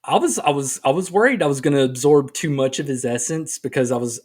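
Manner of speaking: ordinary talk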